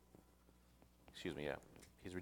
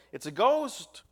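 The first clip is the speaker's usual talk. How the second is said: terrified cry; 10 semitones higher, 9 dB louder, slower than usual. A narrator's reading. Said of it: "They were terrified and said".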